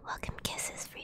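very soft, fully whispered greeting; whispered welcome. Real, not acted. Streamer welcoming a viewer with kisses, whispered. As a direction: whisper it to the listener.

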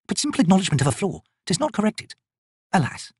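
wizard voice. Acted.

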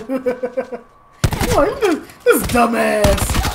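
laughing through the words. Real, not acted. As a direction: crack up at yourself. Laughing at own clumsy run.

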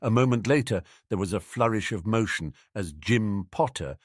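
usual speaking voice

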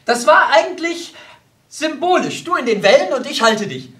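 high, loud, swelling with pride; proud. Acted. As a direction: boast of it grandly and excitedly. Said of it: "Erwiderte Hans stolz".

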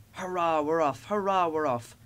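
gleeful engine setting off. A narrator's reading. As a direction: cheer it in bouncy repeated bursts. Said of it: "puffed Thomas gaily".

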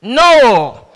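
usual speaking voice

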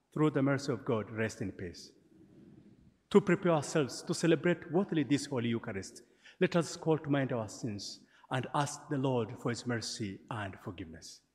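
usual speaking voice

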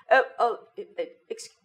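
flustered, stammering British politeness, high; hammed-up apologetic British English; British accent. Shown as a demonstration. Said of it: "Ganun lagi sila".

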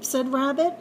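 ordinary delivery, nothing marked

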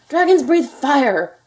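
high, loud, drawn-out outburst; an outraged father hollering; father's shout. A narrator's reading. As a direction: shout it slowly, driving each word home. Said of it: "shouted his father".

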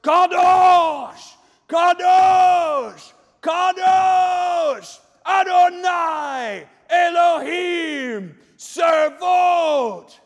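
high full-force shout, each word stretched; thunderous angelic cry. Acted. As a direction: shake the pillars with every word. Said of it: "Everything is trembling as it goes".

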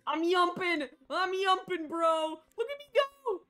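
loud, high gleeful shouts; thrilled to be jumping; excited yelling. Real, not acted.

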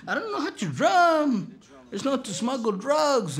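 high-pitched, drawling protest; protesting Bob. Retold or imitated. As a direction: imitate a reluctant brother pleading ignorance. And Bob's like.

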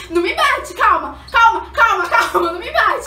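laughing plea. Retold or imitated. Begging mom not to hit.

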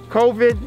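covid punched high and loud; stress on covid. Real, not acted. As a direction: hit covid hard. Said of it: "That's that one word".

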